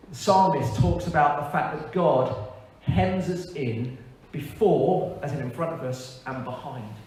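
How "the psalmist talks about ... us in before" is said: normal talking voice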